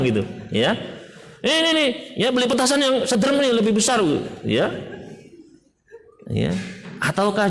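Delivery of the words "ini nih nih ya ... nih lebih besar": high, eager voice offering the knife; pitched way up, cheerfully egging the child on; careless indulgent parent. Pretend. Preacher voicing a careless parent handing over a knife, high and eager.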